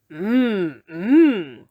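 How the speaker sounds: greedy wolf mm. Acted. Wolf savouring the basket.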